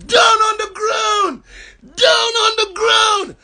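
very high, slow, screamed commands; screaming officers. Retold or imitated. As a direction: yell it like a panicked cop. Officers yelling at Twitch.

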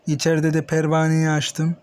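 usual speaking voice

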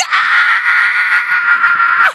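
a strained, drawn-out cry of despair; anguished yell. Acted. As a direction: yell it slowly, agonised. Son misses, parent erupts.

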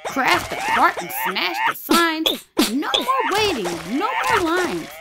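high, loud stomping tantrum chant. Acted. Llama smashing things at the store, loud high tantrum. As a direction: throw a full toddler tantrum in rhythm.

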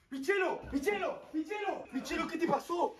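high, worried calls; alarmed calling. Acted. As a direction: call out, getting alarmed. Encuentra a Michelo herido. (llamado alarmado)

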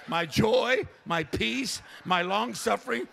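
preacher ramping from loud to shouting; building sermon list. Acted.

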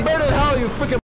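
loud, high taunt; taunting yell. Acted. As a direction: spit it at the burning house. Taunting as he burns house.

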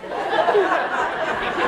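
soft voiceless laugh, trailing down; breathy laugh. Real, not acted. Amused at her own answer to her kids, soft laugh. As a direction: let a quiet airy laugh fall away. Laugh after quoting herself.

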